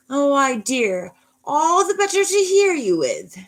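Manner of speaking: very high, slow, loud fake-granny falsetto; big bad wolf. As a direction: croon it as a wolf posing as Grandma. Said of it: "the big bad ... and says".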